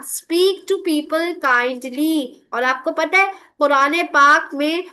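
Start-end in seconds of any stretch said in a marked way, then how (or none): 0.0-2.3 slow teaching. Shown as a demonstration. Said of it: "teacher spells out meaning".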